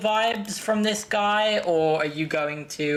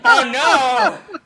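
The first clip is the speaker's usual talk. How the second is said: rising, louder cries of dismay; mock horrified groan; 8 semitones higher, 10 dB louder, much slower than usual. Real, not acted. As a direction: pretend to be horrified by the flirting. Cringing at the flirt scene.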